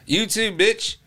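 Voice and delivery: mock retort. Teacher firing back at a student, mock retort.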